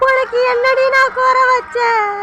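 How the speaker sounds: slow, sobbing call to the runaway daughter; crying wail. Acted. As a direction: wail her name through tears. Mother wails over eloped daughter. (அழுகையுடன் ஓலமிடும் குரல்)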